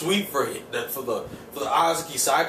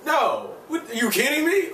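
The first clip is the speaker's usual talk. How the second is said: pitched way up in disbelief; a high, stunned comeback at Nakai; incredulous retort; 12 semitones higher than usual. Retold or imitated. Fukuda scoffing at Nakai's answer, high incredulous disbelief. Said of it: "It's like".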